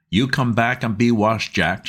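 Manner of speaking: mother calling her son in to wash; shouted call. A narrator's reading. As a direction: call the boy back to be washed. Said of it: "she shouted".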